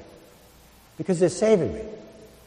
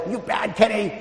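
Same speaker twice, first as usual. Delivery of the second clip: mock yelling; 8 semitones higher than usual. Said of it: "you start yelling and say".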